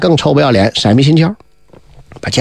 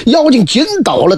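ordinary delivery

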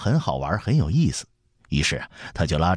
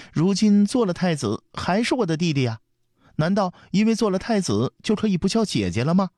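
put-on very high girlish sulk; pouting protest; 12 semitones higher than usual. A narrator's reading. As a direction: argue back indignantly with a pout. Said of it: "阿娇撅着嘴说". (撅着嘴高声不服)

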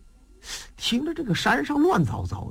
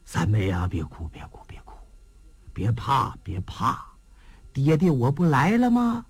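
tender comforting father; 7 semitones lower than usual. Acted. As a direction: hush the crying girl gently. Father comforts weeping daughter.

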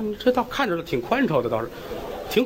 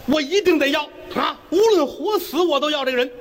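insistent shout; 7 semitones higher than usual. Acted. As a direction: bellow that you want her whatever happens. Ruizi insists on claiming the girl.

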